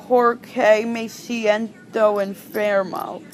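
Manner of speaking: very slow, careful Spanish "Porque me siento enfermo"; teacher's slowed-down model phrase; slow demo. Shown as a demonstration. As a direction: drag out each syllable of "Porque me siento enfermo" for the learner. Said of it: "they might answer saying".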